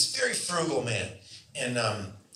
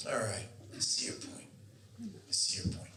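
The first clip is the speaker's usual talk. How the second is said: soft, whispered and breathy; whispered concession; 6 semitones lower, 11 dB quieter than usual. Retold or imitated. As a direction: murmur it like a sly director humouring an actor. Director dryly placating his actor.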